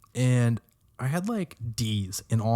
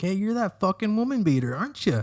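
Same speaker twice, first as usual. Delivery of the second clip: mock taunting stranger; 9 semitones higher than usual.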